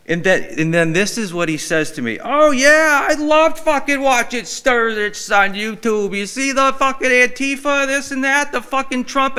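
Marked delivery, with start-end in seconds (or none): 2.2-9.4 high, loud hammed-up biker yell; screaming biker. A deaf biker shouting politics in the aisle, hammed up. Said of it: "this guy's screaming it".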